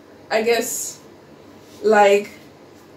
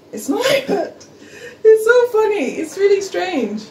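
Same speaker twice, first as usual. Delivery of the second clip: laughing disbelief; 11 semitones higher, 3 dB louder than usual. Real, not acted. Laughs at Australia's difference.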